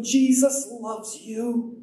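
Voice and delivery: high, slow, drawn-out declaration; retold heartfelt witness; earnest proclamation. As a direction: proclaim it to him, stretching each word.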